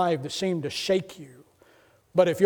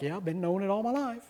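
disdainful wife; 6 dB quieter than usual. Retold or imitated. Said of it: "she's just looking at me like".

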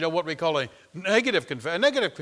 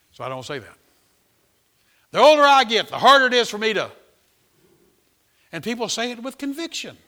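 loud, high preaching; full-force preaching; 6 semitones higher, 10 dB louder than usual. Acted. Preacher driving home that words matter, loud and high. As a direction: thunder it from the pulpit.

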